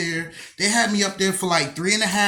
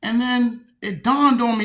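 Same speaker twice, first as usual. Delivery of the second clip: ordinary talk, nothing marked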